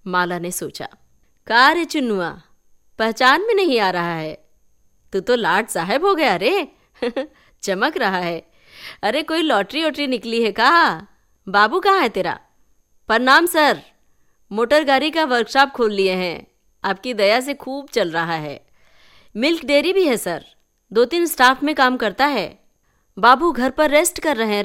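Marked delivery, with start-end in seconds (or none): 1.5-12.4 loud, high, bantering village voice; teasing village elder. Acted. 13.1-24.6 high, loud show-off greeting; showy young man. Acted.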